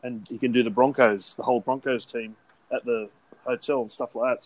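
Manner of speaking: normal talking voice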